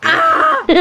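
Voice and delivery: high squeal of delight at the taste. Real, not acted. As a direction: let out a sudden high yelp of pleasure. Reacts to hot food. (taas nga singgit sa kalipay)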